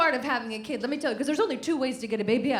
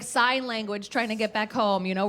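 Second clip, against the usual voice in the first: ordinary delivery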